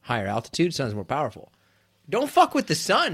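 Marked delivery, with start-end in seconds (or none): 2.1-3.1 mock warning. Comic warning.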